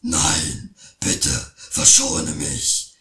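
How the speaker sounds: very low, whimpering troll plea; frightened troll begs the boy, very low; pleading troll. A narrator's reading. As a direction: beg for mercy like a scared troll. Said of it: "sagte der Troll".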